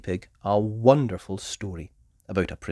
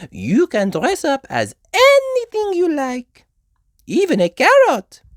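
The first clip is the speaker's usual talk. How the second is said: Madame Gazelle teacher voice; 13 dB louder than usual. A narrator's reading. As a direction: address the class like a sweet schoolmistress.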